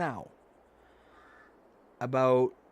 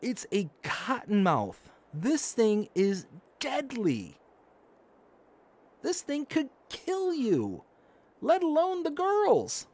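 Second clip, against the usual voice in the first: slow, every word weighted, rising higher to the end; drawn-out warning climbing higher; slow emphatic warning; 10 semitones higher, slower than usual. Retold or imitated. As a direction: spell out the danger slowly and gravely. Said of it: "I'm like".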